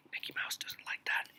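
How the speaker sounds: hushed aside; whispering. Real, not acted. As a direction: whisper it conspiratorially.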